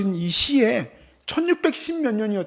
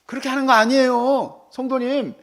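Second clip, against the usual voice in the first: ordinary delivery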